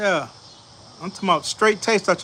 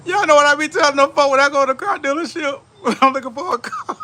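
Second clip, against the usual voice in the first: words rising high, broken by laughter; laughing through words; 8 semitones higher, 3 dB louder than usual. Real, not acted. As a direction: tell it while cracking up. Laughing while telling story.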